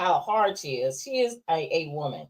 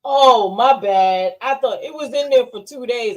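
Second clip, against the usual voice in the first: mock teen son; 2 semitones higher, 5 dB louder than usual. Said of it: "then he'd be like".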